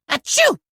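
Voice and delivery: high, sudden pup sneeze. Acted. Chase sneezing while sniffing the scent, a sudden sneeze. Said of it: "Cat hair makes me sneeze".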